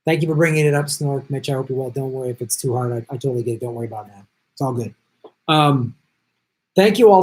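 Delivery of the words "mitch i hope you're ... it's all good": usual speaking voice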